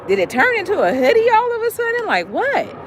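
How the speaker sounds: high, loud exaggerated disbelief; mock panic. Acting out a web spreading everywhere, panicked.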